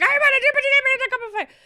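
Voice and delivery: hammed-up shouting headmaster; frantic Dumbledore shout. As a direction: blast it out like the movie's panicked Dumbledore. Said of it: "It's not just like".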